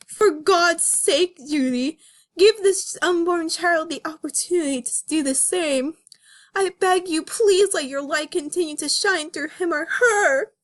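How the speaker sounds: high, tearful begging; pleading. Acted. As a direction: beg her with a breaking voice. Nick begs Judy.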